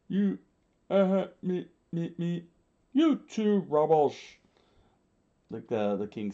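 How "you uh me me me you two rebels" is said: high, halting shout; screamed by commander. A narrator's reading. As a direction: stammer out a raised shout at the rebels. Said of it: "Lord Chaka screamed out".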